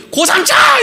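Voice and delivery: high, eager mock crowd yell; high-pitched excited shout; congregation's unison shout. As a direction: blurt it out like a whole crowd answering. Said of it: "다 같이 한 목소리로".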